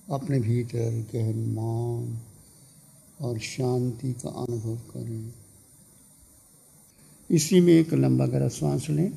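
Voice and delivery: calm, softened meditation voice with long pauses; guided meditation. Real, not acted. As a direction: guide listeners gently into stillness, letting phrases fade. (शांत स्वर में ध्यान का मार्गदर्शन)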